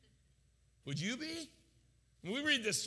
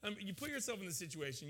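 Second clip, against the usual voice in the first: ordinary talk, nothing marked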